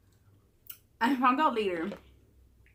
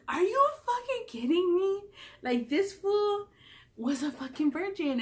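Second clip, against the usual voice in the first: higher, slow, incredulous drawl; much higher slow shock at the friend's news; astonished disbelief; 8 semitones higher, slower than usual. Retold or imitated. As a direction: drag it out in shock.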